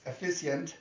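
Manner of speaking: ordinary delivery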